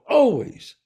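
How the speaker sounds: always punched high and loud; emphatic always. Real, not acted. Telling the listener how fixed the family habit is, emphatic. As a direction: lean hard on always, no exceptions.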